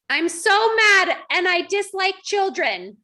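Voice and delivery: playful fake fury at kids; mock angry thought. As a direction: ham up a cranky thought. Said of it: "choose the thought".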